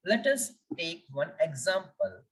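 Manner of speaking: ordinary talk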